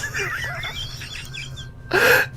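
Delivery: breathy high giggle; a quick airy laugh at his expense. Acted. Giggle after calling Poop useless.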